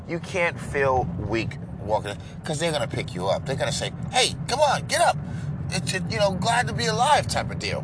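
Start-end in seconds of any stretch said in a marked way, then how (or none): 4.1-7.3 pep talk voice. Retold or imitated. Starbucks staff cheering up a sad customer, high peppy voice. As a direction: lift them up like upbeat staff.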